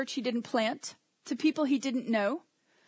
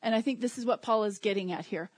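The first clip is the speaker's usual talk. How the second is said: normal talking voice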